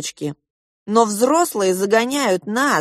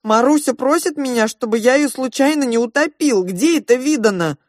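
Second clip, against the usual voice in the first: higher, louder, panicked voice; a scared dad babbling to his wife; panicky father; 5 semitones higher, 3 dB louder than usual. Acted. Panicked father complaining about his baby daughter. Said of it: "паническим голосом".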